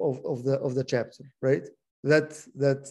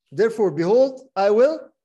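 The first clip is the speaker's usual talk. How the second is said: "I will" pushed out louder; stress on I will; 5 semitones higher, 7 dB louder than usual. Shown as a demonstration. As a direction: lean hard on "I will" while counting. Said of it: "How many "I will" can you count".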